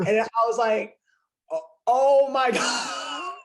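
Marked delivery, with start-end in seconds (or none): 1.5-3.4 loud, high, drawn-out gasp of amazement; re-enacted fan freak-out. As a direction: stretch it out in stunned excitement, breaking into a laugh. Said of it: "So I freaked out".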